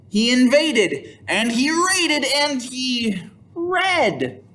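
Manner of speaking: high, slow, each verb punched; rhythmic refrain. A narrator's reading. Reading to children, the big chanted refrain. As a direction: lean on invaded, raided and read. Story refrain, read for emphasis.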